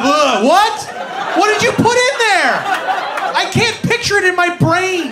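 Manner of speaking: high, loud bewildered protest; confused yelling. Retold or imitated. Patient shouting his confusion at the x-ray tech. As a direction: shout like you're totally lost.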